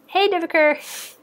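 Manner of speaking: high, drawn-out call across the room. Real, not acted. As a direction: sing out a name to get his attention. Calling out to someone.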